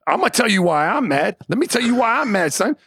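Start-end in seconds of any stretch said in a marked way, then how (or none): none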